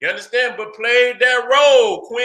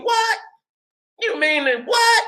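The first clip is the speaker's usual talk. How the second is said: mock disbelief; 10 semitones higher than usual. Retelling shock at bull nuts.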